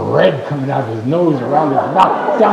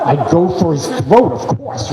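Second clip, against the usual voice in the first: ordinary delivery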